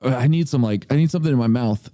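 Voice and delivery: ordinary talk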